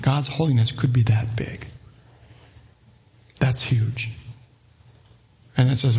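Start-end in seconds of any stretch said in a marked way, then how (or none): 3.4-4.1 breathless hush of awe; awed whisper. Real, not acted. Preacher hushing the congregation in awe, whispered. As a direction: drop to a whisper in wonder.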